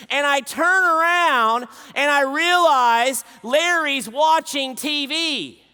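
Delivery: loud, high, drawn-out climax; outraged reveal. Real, not acted. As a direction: stretch out the punchline in loud disbelief. Comic climax of the story.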